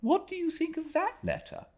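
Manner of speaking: a lightly voiced young man, curious and concerned; voicing Traddles. Traddles asks about the letter.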